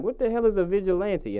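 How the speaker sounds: retold bafflement. Retelling own puzzled reaction.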